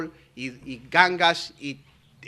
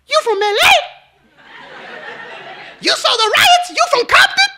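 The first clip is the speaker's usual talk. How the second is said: very high, loud falsetto disbelief; mimicked astonishment; 8 dB louder than usual. Coworker realizing he's from Los Angeles, astonished mock falsetto. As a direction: exclaim each question in a squealing mock voice.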